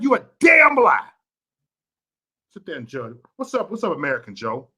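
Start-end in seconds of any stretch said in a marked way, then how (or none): 0.0-1.1 slow, high, loud accusation; shouting. Real, not acted. Streamer yelling at critics, loud and slow. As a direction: bellow it out at the liars. Heated rant to viewers.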